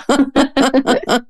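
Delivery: a long high laugh; bright laugh. Real, not acted. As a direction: keep laughing at the pun. Laugh after astrology joke.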